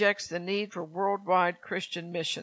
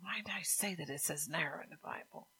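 hushed whisper, puzzled; whispered thought; 3 semitones lower, 12 dB quieter than usual. Retold or imitated. Wondering to herself why she said wide, whispered. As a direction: breathe it as a private doubt.

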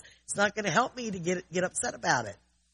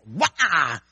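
emotional outburst yelp; 10 dB louder than usual. Shown as a demonstration. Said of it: "hit that button and that".

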